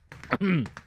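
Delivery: short choking cough. Real, not acted. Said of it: "I'm choking on my coffee".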